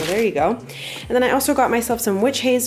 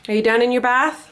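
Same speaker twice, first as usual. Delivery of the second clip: calling out; 3 semitones higher, 7 dB louder than usual. Real, not acted. Calling to kid in bath.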